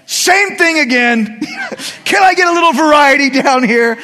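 a high, loud put-on whiny dog; complaining dog voice. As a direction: bark the complaint like a fed-up pet. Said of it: "your dog's like".

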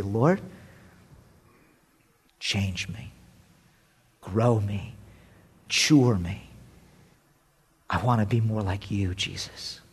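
low, quiet and trailing to a breath; preacher praying for change; soft prayer. Real, not acted. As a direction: pray it gently and humbly. Preacher prays softly.

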